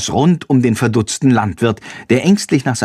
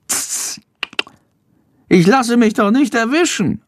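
Schandor boasting; 12 semitones higher than usual. A narrator's reading. Schandor brags to Jendrik after the chase, boastful. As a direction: brag in a piping voice. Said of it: "prahlt Schandor".

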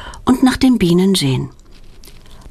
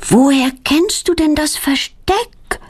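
put-on higher, slow, amazed girl's question; astonished question; 8 semitones higher, slower than usual. A narrator's reading. Claudia wonders how her friend knew the hiding place, amazed. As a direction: sound truly taken aback. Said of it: "fragt Claudia erstaunt".

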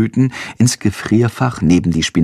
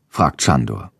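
plain reading voice

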